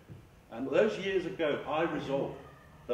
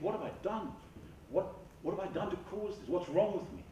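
higher-pitched worried questioning; mock inner panic, pitched up; acted self-doubt; 6 semitones higher than usual. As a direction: play anxious thoughts starting to spiral. Acting out spiralling self-doubting thoughts.

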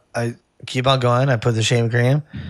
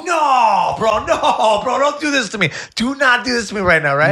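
panicked pleading; 11 semitones higher than usual. Retold or imitated. Begs the client to stop.

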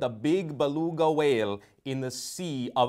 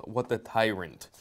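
usual speaking voice

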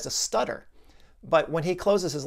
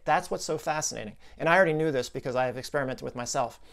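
ordinary delivery

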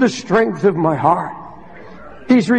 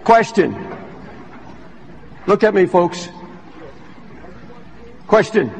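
lower, hushed pastoral appeal; 4 semitones lower than usual. Real, not acted. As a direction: draw them in gently, lower. Quiet altar call to congregation.

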